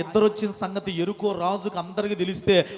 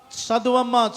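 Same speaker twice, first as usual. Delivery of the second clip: ordinary talk, nothing marked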